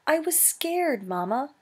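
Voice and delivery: put-on high, plaintive young voice; scared cry. Frightened gosling explaining himself to his mother, high and plaintive. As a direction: plead it in a small high voice. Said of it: "cried the goose".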